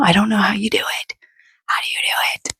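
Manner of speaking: hushed whisper. Real, not acted. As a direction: whisper it like a confession. Mom confiding about YouTube struggles.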